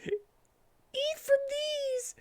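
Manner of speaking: very high, very slow warbling voice; like a broken talking toy; breaking toy voice. Acted. Cream offering to Tails, broken-toy high voice. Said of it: "her voice was breaking like a talking toy".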